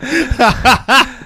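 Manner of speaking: high-pitched laughing repeat. Real, not acted. Friend cracking up over a movie line, laughing. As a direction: squeak it out through laughter.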